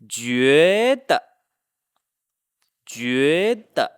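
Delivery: 觉得 with 觉 rising long and 得 short; neutral-tone ending. Shown as a demonstration. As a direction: sweep 觉 upward, drop a quick toneless 得. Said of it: "kind of like a toneless sound".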